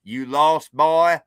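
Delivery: menacing Southerner. Retold or imitated. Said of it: "and they say".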